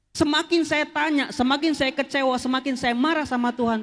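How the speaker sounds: ordinary delivery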